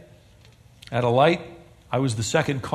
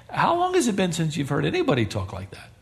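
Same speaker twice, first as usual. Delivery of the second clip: usual speaking voice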